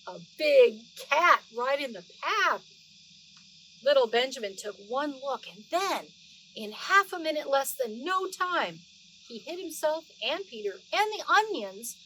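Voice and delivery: lively read-aloud storytelling voice; story narration. Acted. Grandma reading Beatrix Potter to the children, animated storytelling voice. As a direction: read it like a bedtime story for little ones.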